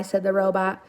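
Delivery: plain reading voice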